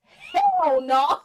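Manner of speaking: high, loud drawn-out cry; hammed-up group shriek; panicked refusal. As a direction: holler it in mock horror. All three cry out, fleeing.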